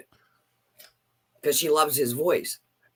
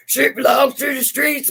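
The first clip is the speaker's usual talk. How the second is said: higher, louder hammed-up shout; mock shouted clip line; 10 semitones higher, 5 dB louder than usual. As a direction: bark it like a silly sound clip. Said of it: "my mom's face".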